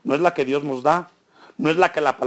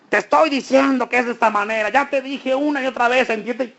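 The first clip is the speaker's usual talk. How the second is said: higher, insistent mock scolding; scolding parent; 5 semitones higher than usual. Preacher imitating a parent scolding their child, exasperated. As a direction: nag like a fed-up parent lecturing a child.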